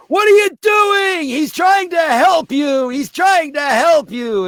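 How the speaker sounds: put-on high, slow shouted kids' voices; kids yelling. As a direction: shout it like a hall full of upset kids. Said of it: "the kids are yelling".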